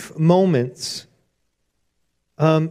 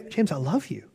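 tender God voice; 8 dB quieter than usual. Retold or imitated. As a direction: say it like a loving father. God speaks to him.

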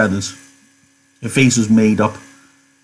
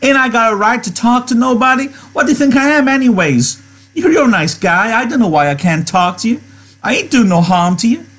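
much higher, indignant woman's voice; angry cry; 12 semitones higher than usual. A narrator's reading. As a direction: protest hotly in a high American character voice. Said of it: "Her face grew angry".

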